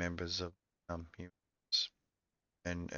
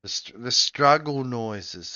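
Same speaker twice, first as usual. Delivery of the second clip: slow, louder straining voice; struggle noises; 4 semitones higher, 9 dB louder, slower than usual. Shown as a demonstration. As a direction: strain through the words like lifting. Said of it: "the grunts and the".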